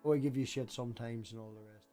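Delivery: ordinary talk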